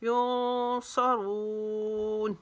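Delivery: युनसरून very slow with nasal ikhfa, रून long; slow recited युनसरून; tajweed recitation. Shown as a demonstration. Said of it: "रून को लंबा खींचेंगे".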